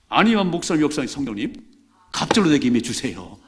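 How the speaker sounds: crying out for a double portion; loud prayer. Shown as a demonstration. Pastor showing the congregation how to pray, loud plea. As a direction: call out the prayer with full force.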